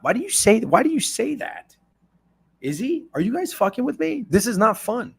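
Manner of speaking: high-pitched anxious pleading; panicked. Real, not acted. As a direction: plead with the chat, rattled. Panicking over golfer news.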